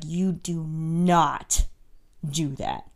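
very slow, measured reprimand; stern mom scolding. Retold or imitated. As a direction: imitate a mom laying down the law word by word. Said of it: "spanked him real hard and was like".

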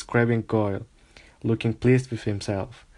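usual speaking voice

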